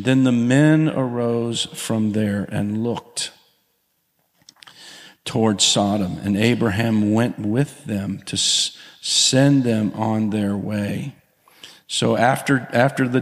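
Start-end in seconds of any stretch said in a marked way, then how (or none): 0.0-11.1 scripture reading. Acted.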